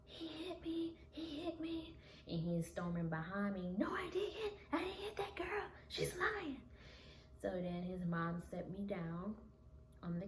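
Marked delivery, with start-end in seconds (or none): 0.1-1.9 high-pitched blurted accusation; blurting out. Retold or imitated. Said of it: "blurting out". 3.8-6.6 imitated high-pitched protest; indignant denial. Boyfriend follows, denying the hit.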